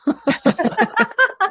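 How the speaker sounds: loud, high burst of laughter; long laugh at your own joke; hearty laugh. Real, not acted. Laughs at own show-name joke.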